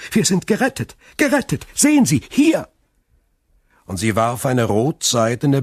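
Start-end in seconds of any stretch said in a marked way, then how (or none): 0.1-1.0 much higher and louder, overjoyed; joyful cry. A narrator's reading. Daughter tells her ruined father they are saved, overjoyed. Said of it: "rief sie". 1.2-2.7 even higher, loud and jubilant; the joy peaks here; ecstatic cry. A narrator's reading. Daughter shows her father the purse, ecstatic. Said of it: "rief sie".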